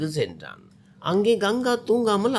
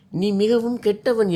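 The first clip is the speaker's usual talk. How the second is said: put-on louder, higher wail like a small child; childlike crying; 4 semitones higher, 4 dB louder than usual. A narrator's reading. Ganga wailing at Arun like a small child, tearful and loud. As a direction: cry it out like a hurt little girl. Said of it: "ஒரு சிறு குழந்தையை போல அழத் தொடங்கினாள்".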